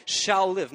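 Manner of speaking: preacher's loud insistent "shall"; emphatic repeat. Shown as a demonstration. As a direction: drive "shall live" home to the congregation. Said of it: "I need you to hear that".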